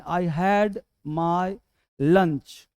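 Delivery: "I had my lunch" said very slowly, word by word; slow dictation. Shown as a demonstration. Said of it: "अक्सर पूछता हूँ बच्चों से मैं".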